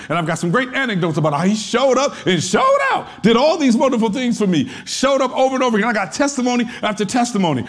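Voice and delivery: hammed-up praise report, rattled off fast at the end; mock excited testimony. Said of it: "You give some really good answers".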